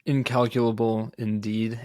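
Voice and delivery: ordinary delivery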